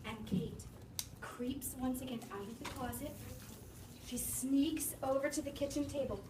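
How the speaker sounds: soft, quiet sneaking narration; hushed suspense. A narrator's reading. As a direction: tell it quietly as she creeps. Narrating Kate creeping.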